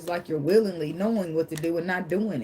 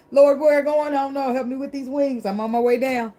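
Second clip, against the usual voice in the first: mock panic; 8 semitones higher, 6 dB louder than usual. Leaping, calling to the Lord.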